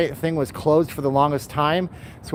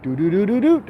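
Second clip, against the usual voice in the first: quick "do-do-do" device jingle; imitated Bluetooth reboot chime; 3 semitones higher than usual. Livestreamer pretends to reboot, imitating his Bluetooth's sound. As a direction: mimic a gadget starting up. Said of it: "That's the sound my Bluetooth makes".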